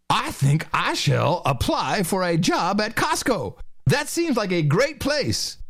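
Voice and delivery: mock naive woman. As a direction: play a naive lady deciding grandly, drawn out. Acts woman watching TV.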